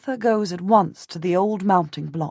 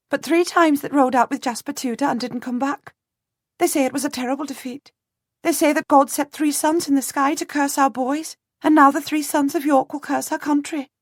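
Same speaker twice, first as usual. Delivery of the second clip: put-on high, quick voice of a flustered peasant girl; a startled servant girl piping up to a lord; 8 semitones higher than usual.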